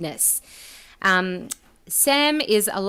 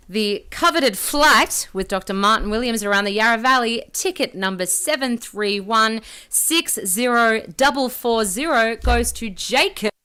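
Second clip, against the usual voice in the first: booming prize announcer; 3 semitones higher, 5 dB louder than usual. Acted. Top raffle prize announced.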